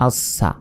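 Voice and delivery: আস সা as as-sa, the hiss held across; doubled consonant. Shown as a demonstration. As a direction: sound the s at both ends in আস সা. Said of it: "দুইবার উচ্চারণ হবে". (আস সা, স দুইবার)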